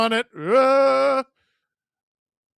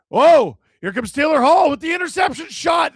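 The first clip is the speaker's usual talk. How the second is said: sudden loud, higher burst of play-by-play; excited shout; 12 semitones higher, 12 dB louder than usual. Real, not acted. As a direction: jolt up as a chance opens. Sudden scoring chance.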